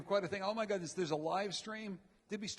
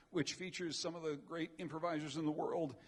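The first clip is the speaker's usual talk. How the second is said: ordinary talk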